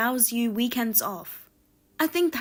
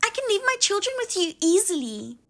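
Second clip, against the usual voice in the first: higher-pitched, huffy sister-in-law voice; indignant sister-in-law; 5 semitones higher than usual. Acted. Sister-in-law scolding her brother's wife, indignant and higher. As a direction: play the offended sister-in-law taking offence.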